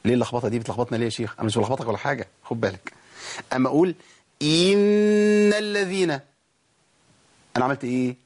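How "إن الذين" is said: "إن الذين" as a long "eenna", loud and high; stretched kasra error. Shown as a demonstration. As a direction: lean on the vowel of "إن" before a long humming ن. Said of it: "وولدت منها حرف مد، ضغطت عليها ليه؟". (توليد ياء من كسرة الهمزة في "إن")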